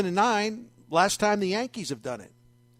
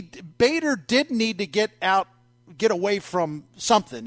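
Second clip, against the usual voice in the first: ordinary delivery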